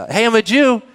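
smug mock claim. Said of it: "because they were just".

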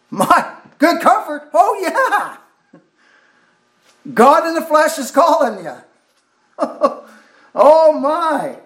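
very high, joyful exclamations breaking into a laugh; exultant preaching. Real, not acted. Preacher rejoices that God calls you, exultant.

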